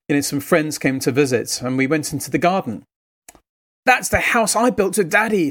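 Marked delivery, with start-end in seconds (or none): 3.8-5.5 put-on higher, childlike proud voice; proud little boy. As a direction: show off the playhouse like a toddler. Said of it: "said Ethan proudly".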